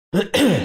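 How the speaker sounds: sharp throat clearing cough. Real, not acted. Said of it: "Sorry, I'm coughing so much".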